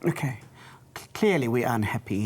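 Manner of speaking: ordinary talk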